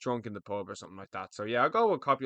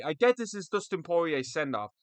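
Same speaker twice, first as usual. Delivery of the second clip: ordinary delivery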